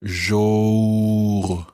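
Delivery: Jour, lips rounded. Shown as a demonstration. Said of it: "and then rounding your lips".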